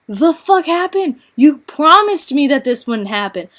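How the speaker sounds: high, loud outraged disbelief; indignant. Retold or imitated. After the breakup, confronting the ex who went silent, indignant. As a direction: demand an answer, betrayed. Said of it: "you're just like".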